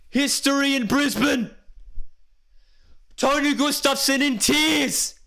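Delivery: high, drawn-out shouts of a winning moment; euphoric live commentary. Real, not acted. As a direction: roar out the historic win, stretching key words. Live play-by-play celebration.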